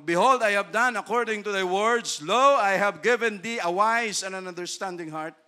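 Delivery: God's promise to Solomon, loud and grand; scripture reading. Acted. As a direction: read it like God speaking. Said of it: "quotes scripture".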